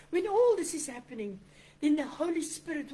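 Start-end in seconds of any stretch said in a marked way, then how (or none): none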